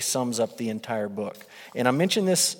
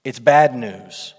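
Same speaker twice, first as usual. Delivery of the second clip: "bad news" slow and much louder; bad news emphasized; 2 semitones lower, 8 dB louder, slower than usual. Real, not acted. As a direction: lean hard on "It's bad news". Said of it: "it is no good news".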